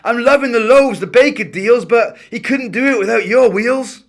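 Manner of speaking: put-on boy shouting through a van window. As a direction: wake the sleeping driver with a shout. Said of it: "banged the window and shouted".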